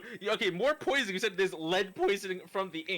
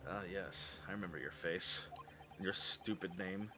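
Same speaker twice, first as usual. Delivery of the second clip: much lower, quieter menacing voice; sinister low voice; 10 semitones lower, 11 dB quieter than usual. Acted. Voicing a villainous character.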